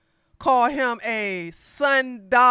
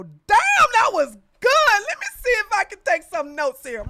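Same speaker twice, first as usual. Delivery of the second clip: high, loud mock admiration then quick; Shiloh Hendrix impression; 11 semitones higher, 7 dB louder than usual. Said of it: "would make Shiloh Hendrix go".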